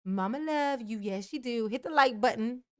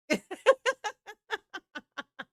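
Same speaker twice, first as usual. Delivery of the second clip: high laugh; 10 semitones higher than usual. Real, not acted. Laughs after teasing.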